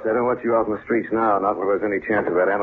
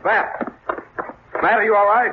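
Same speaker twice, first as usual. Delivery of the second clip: calling out; 13 semitones higher than usual. Acted. Calling to the attacked man.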